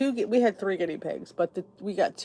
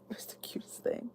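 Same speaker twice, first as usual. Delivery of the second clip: soft whispered aside; fond whisper; 16 dB quieter than usual. Real, not acted. Remembering her hopping guinea pig, a soft whisper. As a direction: breathe it out, fondly, as a whisper.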